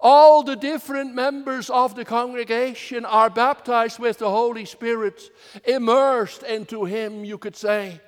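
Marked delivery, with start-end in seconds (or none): 0.0-5.3 All hit louder at the start; stress on ALL. Shown as a demonstration. Preacher stressing All to the congregation, louder. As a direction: lean on All, then carry on. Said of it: "I put the emphasis on the word all".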